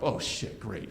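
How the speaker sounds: hushed panic. Retold or imitated. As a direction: mutter it under your breath so she won't hear. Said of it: "I've woken my wife up".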